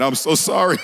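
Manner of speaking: ordinary delivery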